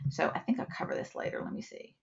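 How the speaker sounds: whispered to herself, fast, while checking notes; muttered aside. Real, not acted. Presenter losing her place whispers to herself. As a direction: drop to a private whisper.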